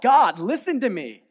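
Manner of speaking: loud, high pleading yell; desperate yell to God. Retold or imitated. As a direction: cry out to God with everything. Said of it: "he yells out".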